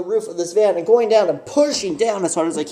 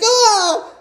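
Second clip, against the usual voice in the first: high, loud mock scream; startled scream; 13 semitones higher, 3 dB louder than usual. Caught by the stranger at the window, high loud startled scream. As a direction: shriek it out. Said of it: "I'm like".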